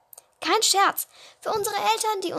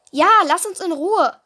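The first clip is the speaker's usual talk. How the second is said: firm backup; 3 semitones higher, 8 dB louder than usual. Acted. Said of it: "bekräftigte ich".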